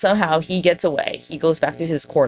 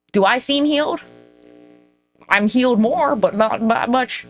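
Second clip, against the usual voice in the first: mock questioning, higher; 7 semitones higher than usual. Real, not acted. Self-mocking about being healed.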